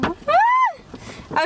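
high-pitched squeal of excitement; excited squeal. Real, not acted. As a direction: let out a high, drawn-out "ah" of delight. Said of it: "Sorry, I'm too excited".

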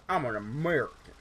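a hint of a hammed-up American accent; mock American accent. Gamer showing off the American accent he promised. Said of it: "There's the American accent I promised you".

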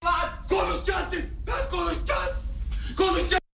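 high, strained shouts over the brawl; high shouting for calm in the fight; high desperate shouting. Acted. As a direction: yell at everyone to stop and talk. Brawl, trying to restore order.

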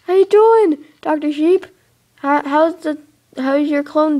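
pet-talk greeting. Real, not acted. Greeting his pet sheep.

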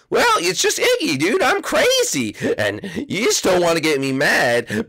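high, unhinged little Koopa voice; crazy Iggy. Acted. Iggy warning the visitor not to make him mad, high and unhinged. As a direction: sound a little unhinged, then laugh it off.

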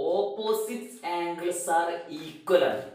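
very slow, word by word; teacher stating the lesson's rule, very slowly; slow dictation. Shown as a demonstration. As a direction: spell out the rule for the class. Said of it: "ഇതിന്ന് മനസ്സിലാക്കാനുള്ള പാഠം".

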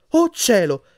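high, loud gasped exclamation; breathless astonishment. A narrator's reading. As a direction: exclaim in sudden shock. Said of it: "esclamò Hermione quasi senza fiato".

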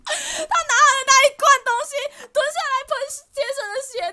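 high, loud laughing through the words; cracking up mid-story; laughing while retelling the funny moment; real laughter. Real, not acted.

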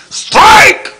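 very high shouted umpire call. Acted. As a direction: belt it like an umpire. Umpire calls strike. (grito de ampáyer, muy agudo y fuerte)

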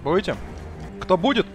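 ordinary talk

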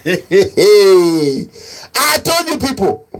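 laughing. Real, not acted. Laughing through mocking remark.

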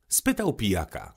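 ordinary delivery, nothing marked

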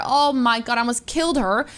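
normal talking voice

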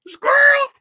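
very high, loud comic yelp; mock distracted cry. Streamer mocks own wandering attention, high loud yelp. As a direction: blurt it as a squealed interruption.

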